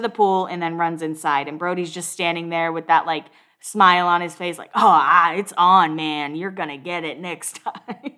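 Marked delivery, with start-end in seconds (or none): 4.7-8.1 put-on Southern drawl, pitched about usual; Southern accent. Brody threatening payback after the pool push, in a Southern accent. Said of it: "why he's now Southern".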